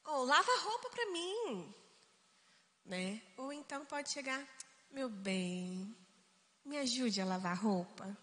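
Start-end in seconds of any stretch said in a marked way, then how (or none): 0.0-1.7 higher-pitched, brusque household order; rude demand. Shown as a demonstration. Spouse demanding laundry help at home, rude. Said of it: "de uma maneira rude". 4.9-8.2 low, soft, sweet coaxing; sweet request. Shown as a demonstration. Spouse gently asking for laundry help, sweet. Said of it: "de uma maneira doce".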